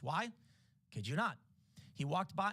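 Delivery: ordinary talk, nothing marked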